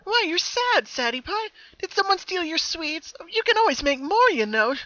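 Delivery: very high and loud, perky; happy Pinkie nicknaming her double, sing-song. Acted. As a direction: chirp it with cheery cruelty. Happy twin teases Sadie.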